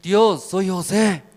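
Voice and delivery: loud, high call to God; José. Acted. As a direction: slowly announce yourself to heaven. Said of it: "Hermano José".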